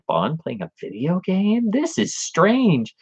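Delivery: slow, high puzzled wonder; drawn out and bewildered; puzzled kid. Retold or imitated. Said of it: "I'm like".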